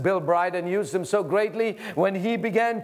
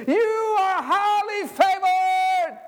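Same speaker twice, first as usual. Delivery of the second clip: slow, high, shouted divine declaration; voice of God; 13 semitones higher, 3 dB louder, much slower than usual. Acted. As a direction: boom it out as God speaking. God's voice quoted.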